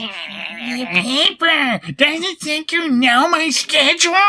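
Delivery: high, hammed-up whine; mock whiny complaint. [name] griping about her deadline, hammed up and high. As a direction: whine it like a spoiled diva.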